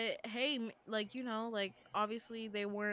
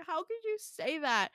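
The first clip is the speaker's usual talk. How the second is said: hurt; 7 semitones higher than usual. Retold or imitated. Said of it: "really emotional".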